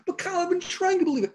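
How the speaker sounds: high, fast whiny protest from a listener; mock the frustrated believer pleading his case; doubting listener. Preacher voicing a listener who keeps trying, high and quick.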